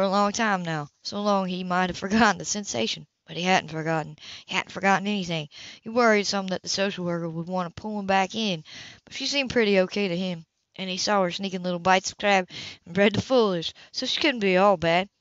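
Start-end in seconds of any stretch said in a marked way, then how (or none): none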